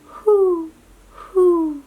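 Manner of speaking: high, loud hooting owl call; playful owl call for the kids. Retold or imitated. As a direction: hoot it out like a big owl. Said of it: "they go".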